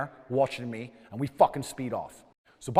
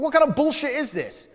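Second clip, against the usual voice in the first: high, louder incredulous shout; pitched way up, furious; angry yell; 12 semitones higher, 3 dB louder than usual. Retold or imitated. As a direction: hurl it at her in disbelief. Yelling at police officer.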